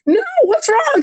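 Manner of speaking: concerned supervisor. Retold or imitated. Quoting her supervisor on the phone, a high concerned voice. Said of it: "she's like".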